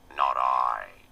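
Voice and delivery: low, loud piggy grunt of a refusal. A narrator's reading. The pig refusing to thresh, low grunting voice. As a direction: grunt the words like a lazy pig. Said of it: "with a grunt".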